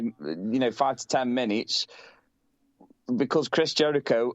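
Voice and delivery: ordinary talk, nothing marked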